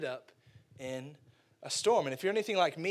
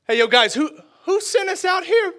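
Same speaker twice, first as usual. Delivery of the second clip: loud, high, frightened call; frightened fisherman; panicked disciple; 13 semitones higher, 13 dB louder than usual. Acted. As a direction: ask in rising panic. Disciples voiced panicking in storm.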